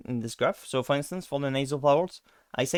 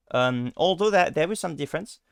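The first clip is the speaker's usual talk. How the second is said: normal talking voice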